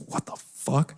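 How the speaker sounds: hushed, stunned mock reaction; whispered disbelief. Voicing the panel's baffled faces, whispered disbelief. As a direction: whisper it as a silent room's thought. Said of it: "everyone's faces were just like".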